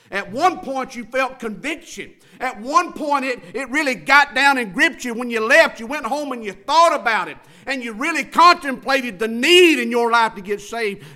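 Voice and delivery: loud, high-pitched pulpit cry; full-force preaching. Real, not acted. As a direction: drive it at the congregation, stretching the big words.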